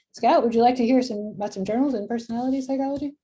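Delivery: sing-song voice for the excited dog; pet talk. Real, not acted. Talking to her excited dog.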